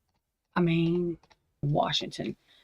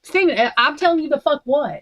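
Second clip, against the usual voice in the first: higher, louder furious warning; angry yell; 8 semitones higher, 5 dB louder than usual. Real, not acted. Scolding Steven for cursing at her, angry raised yell. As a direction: snap at him, raised and heated. Yelling at Steven over dog.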